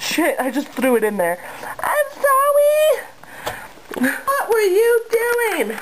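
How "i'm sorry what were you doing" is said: slow, high sorry to the pet; high apologetic coo. Real, not acted. Apologizing to the cat, high slow baby talk.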